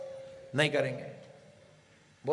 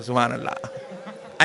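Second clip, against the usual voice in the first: low, slow, drawn-out सुभान अल्लाह with long aa; respectful praise; 9 semitones lower, 5 dB quieter, slower than usual. Shown as a demonstration. Preacher shows the crowd the polite way, reverent. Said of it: "अच्छा करे तो बोले से".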